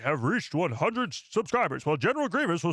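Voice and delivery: dramatic newsreel announcer, pitch swooping up; Clone Wars narrator. Acted. Streamer announcing the subscriber milestone on request, Clone Wars narrator impression. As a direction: proclaim it like a war bulletin. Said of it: "doing your Clone Wars narrating voice".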